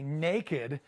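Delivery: ordinary talk, nothing marked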